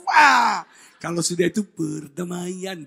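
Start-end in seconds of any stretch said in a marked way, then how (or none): 0.1-0.6 loud burst from a pout; sudden outburst. Retold or imitated. Wife breaks out of her sulk, loud burst. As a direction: let a pout explode loudly. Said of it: "dari manyun langsung".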